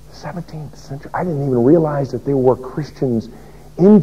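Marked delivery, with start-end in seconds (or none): 0.1-1.1 barely voiced, stunned "Seventeenth century?"; hushed astonishment. Preacher retelling his stunned question to the martyr. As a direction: ask it under your breath in disbelief.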